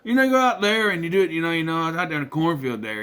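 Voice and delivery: quick mock Iowa country twang. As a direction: imitate a rural Iowan's country twang. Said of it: "country twang".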